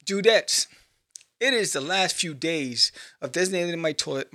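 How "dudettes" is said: ordinary talk, nothing marked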